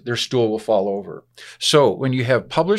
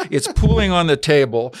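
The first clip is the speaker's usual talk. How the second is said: ordinary talk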